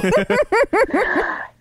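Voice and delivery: high, breathy burst of laughter; sheepish giggle; embarrassed laugh. Real, not acted. Embarrassed about husband's name.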